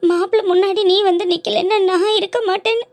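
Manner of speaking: crying over a forced marriage. Acted. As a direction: speak through sobs as you tell it. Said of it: "ஏ, அழுகாதல முதல்ல".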